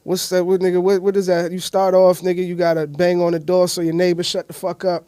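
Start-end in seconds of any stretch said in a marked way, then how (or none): none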